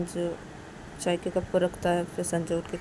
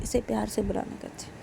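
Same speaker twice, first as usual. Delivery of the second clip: quietly insistent; subdued repetition; 2 semitones higher, 6 dB quieter than usual. Acted. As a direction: drop the volume and say it under control. Uma repeats it, second take.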